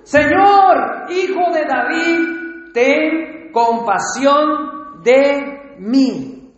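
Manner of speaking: desperate cry for mercy, stretched out slow. Acted. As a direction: cry out to the Lord for mercy, slowly. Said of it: "salió al encuentro gritando".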